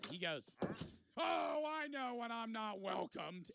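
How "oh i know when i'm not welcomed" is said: drawn-out, higher-pitched imitation of a miffed guest; mock offended huff. Man told to leave storms out, mock huffy. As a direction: act out a wounded, huffy exit line, slowly. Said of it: "he gets mad".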